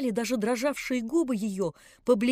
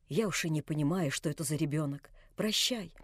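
put-on lower, softer, defeated old man; crushed farewell; 7 semitones lower, 7 dB quieter than usual. A narrator's reading. Old man leaving Vanya, crushed. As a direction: say it as if struck down. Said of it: "Он был как убитый".

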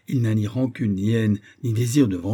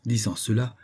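plain reading voice

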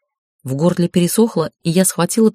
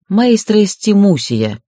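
confident; 4 semitones higher, 5 dB louder, slower than usual. Acted. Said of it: "уверенно сказала я".

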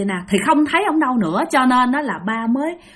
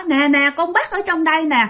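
put-on scared child; 7 semitones higher than usual. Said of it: "thì ba mới nói".

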